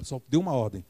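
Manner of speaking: ordinary delivery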